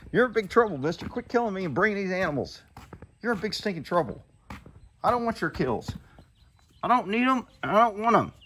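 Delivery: a touch of fake sternness; mock scolding.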